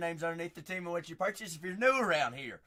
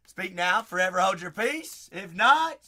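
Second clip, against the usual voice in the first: playful wedding officiant boom; mock wedding officiant; 3 semitones higher, 10 dB louder than usual. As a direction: ham up the wedding line. Mock wedding vow line.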